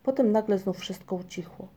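usual speaking voice

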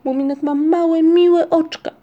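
high, loud taunting comparison; acted childish yell. A narrator's reading. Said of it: "wrzasnął Ryjek".

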